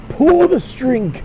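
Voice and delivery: high, slow doll pull-string line; chirpy toy voice command; talking doll. Acted. Reading doll's voice box prompt.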